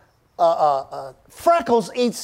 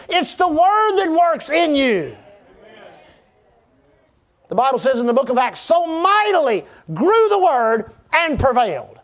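driving sermon delivery with punched key words; emphatic preaching; 4 semitones higher than usual. Real, not acted. Preacher tells congregation of their new identity, emphatic preaching. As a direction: preach it in rising and falling phrases.

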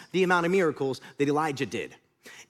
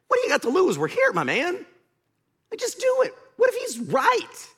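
high, pleading persuasion; urging servant; 12 semitones higher, 4 dB louder than usual. Retold or imitated. Servant talking his angry master into just washing, urgent pleading. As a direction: coax with rising, acted exasperation. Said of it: "the servant's approach of humility and respect".

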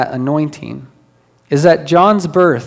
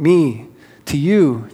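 usual speaking voice

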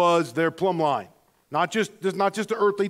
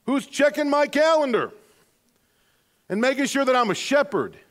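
emphatic reply; 7 semitones higher than usual. Pretend. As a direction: proclaim it boldly to the apologizer.

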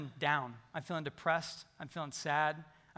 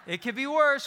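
higher and louder, laughing it out; laughing exclamation, pitched up; 6 semitones higher, 6 dB louder than usual. Real, not acted. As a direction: burst out laughing at the line.

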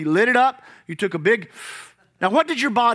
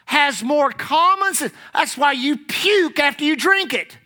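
normal talking voice

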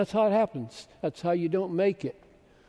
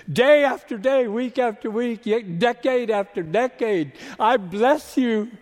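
fervent preaching; 6 semitones higher, 7 dB louder than usual. Real, not acted. Preaching blessing at full force.

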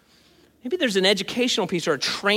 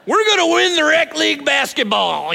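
mock coach yell; 6 semitones higher, 5 dB louder than usual. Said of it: "And I would yell that at my eight-year-olds".

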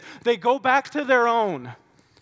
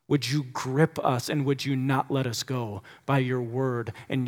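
low, earnest pleading prayer; heartfelt prayer; 7 semitones lower, 3 dB quieter than usual. Real, not acted. Pastor begging God to speak before the sermon, heartfelt prayer. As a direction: pray low and measured, easing softer toward amen.

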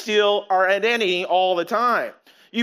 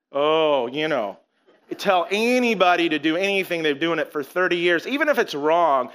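ordinary talk

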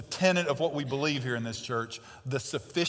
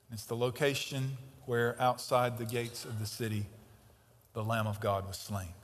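hushed solemn; 5 semitones lower, 7 dB quieter than usual. Acted. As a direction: drop low and soft for the slain Lamb. Solemn climax about the Lamb.

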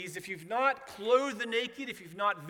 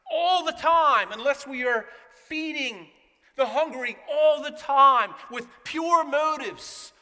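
louder, higher, hammering each all the time; fervent preaching; 3 semitones higher, 7 dB louder than usual. Acted. Pastor pressing the congregation on the law, fervent preaching. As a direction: preach it hard, building the point.

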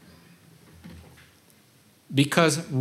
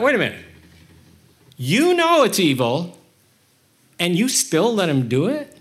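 high, louder protest of disbelief; indignant disbelief; 4 semitones higher, 4 dB louder than usual. Retold or imitated. Speaker protesting that God let the evil happen, high and incredulous. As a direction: challenge God, pitched up and incredulous. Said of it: "And I thought".

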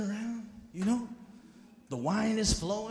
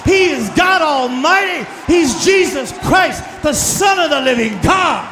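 full-force preaching; 6 semitones higher, 16 dB louder than usual. Acted. Preacher shouting praise to the congregation. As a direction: preach it out to the whole church.

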